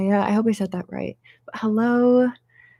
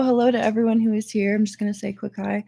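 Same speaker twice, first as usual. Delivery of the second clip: usual speaking voice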